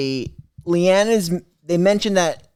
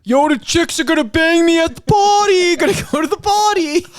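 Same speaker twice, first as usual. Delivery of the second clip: loud high party bro voice; tipsy party chatter; 11 semitones higher, 4 dB louder than usual. Retold or imitated. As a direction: yell it like a hyped party bro. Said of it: "That's my Shane party impression".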